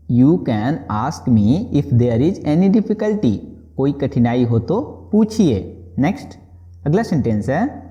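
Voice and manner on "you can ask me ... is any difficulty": very slow, word by word; slow model sentence. Shown as a demonstration. Teacher modelling the English phrase slowly for students. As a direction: spell it out slowly for learners. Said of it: "इसको अंग्रेजी में आप बोलेंगे".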